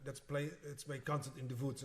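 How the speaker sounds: put-on Russian accent, w said as v; lightly imitating a Russian pianist's accent. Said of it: "she has this really Russian accent".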